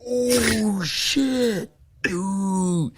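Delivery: high mock alarmed exclamation; shocked. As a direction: gasp it out like you saw him get crushed. Teammates shocked by the accident.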